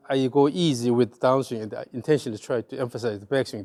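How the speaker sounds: ordinary delivery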